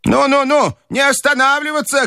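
put-on very high old-lady cry; high-pitched imperious calling out; shouting grandmother. A narrator's reading. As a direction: urge them on in a high, insistent voice. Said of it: "кричала бабушка".

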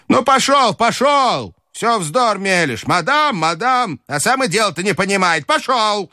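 put-on high, scolding old lady; impatient old grandmother. A narrator's reading. Grandmother losing patience with the Frenchman, high scolding. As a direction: shoo him away, exasperated.

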